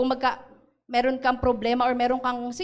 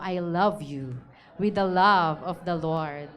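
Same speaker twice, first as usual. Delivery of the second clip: modeled tender line; 6 semitones lower, slower than usual. Shown as a demonstration. Pastor shows the congregation what to tell a neighbour, tender. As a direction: say it gently as a model. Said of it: "sabi mo".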